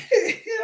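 sudden high laugh. Real, not acted. Laughs at God's surprise.

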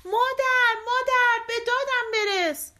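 high, loud desperate call; Tom's cry for help. A narrator's reading. Tom calling mother from the cow's mouth, high and loud. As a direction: yell for mother from inside the cow. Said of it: "تام فریاد زد".

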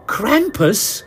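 high, startled echo of the name; gasped question. Acted. Grendel startled by the demon's name, gasping. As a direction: hear the name and recoil. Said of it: "Grendel gasped".

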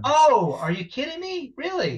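high, loud, genuinely stunned; real surprise. Real, not acted. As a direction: jump up in pitch at the news. Surprised by plant-based wipes.

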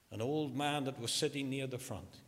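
normal talking voice

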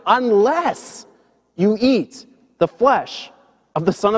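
slow, weighty quote with high, stretched key words; emphatic declaration. Retold or imitated. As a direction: declare it phrase by phrase, pausing between. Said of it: "It's very emphatic".

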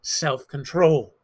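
slow, drawn-out self-control with a grudging sigh; preacher admitting with a grin that he's not keen on self-control; reluctant. Shown as a demonstration. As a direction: drag out self-control as if you'd rather skip it. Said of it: "the one I'm not too interested in".

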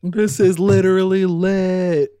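high, loud, drawn-out mock cheer; crowd losing their minds. Host playing the crowd watching flames, wildly hyped. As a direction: stretch it out like an ecstatic fan. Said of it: "losing their mind".

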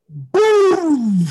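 loud, high imitated blast; explosive boom. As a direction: let the boom burst out and trail away. Imitates the grill blowing up.

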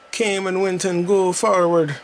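high, put-on Jamaican accent with patois slang. Gamer riffing on [name] in a mock Jamaican accent. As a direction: slip into a hammed-up Jamaican accent. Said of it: "why am I doing a Jamaican accent?".

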